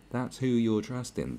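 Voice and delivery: ordinary delivery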